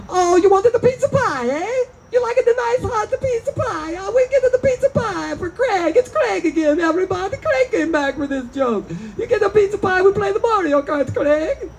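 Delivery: very high mock-Italian singsong voice; cartoonish, high-pitched Italian-Transylvanian accent; Italian accent turning Transylvanian. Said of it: "that accent got real Transylvanian".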